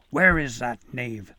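loud, slow angry bellow; bellowing foreman. Acted. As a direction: bellow it at the room. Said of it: "the foreman bellowed".

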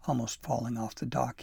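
ordinary delivery

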